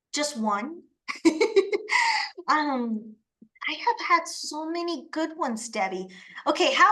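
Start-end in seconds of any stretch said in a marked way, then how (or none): 1.1-2.4 laugh. Real, not acted. Laughing at being asked one.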